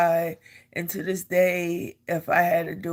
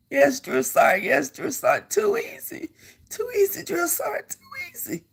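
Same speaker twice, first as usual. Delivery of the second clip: much higher, strained recruit reply; recruit sounding off; 11 semitones higher than usual. Retold or imitated. Recruit answering the drill sergeant, higher strained sound-off. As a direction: bark it back like a choking recruit.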